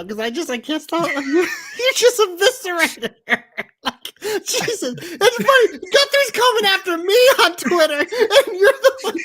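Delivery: can't stop laughing while getting the words out. Real, not acted. Co-host cracking up at the host's brutal rant, high laughing talk.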